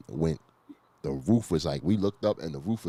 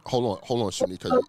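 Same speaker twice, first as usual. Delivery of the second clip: ordinary talk, nothing marked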